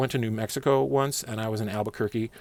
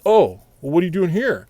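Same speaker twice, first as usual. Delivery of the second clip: louder, higher mock surprise; surprised boss; 8 semitones higher, 9 dB louder than usual. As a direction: catch him off guard and demand why he's here. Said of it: "He says".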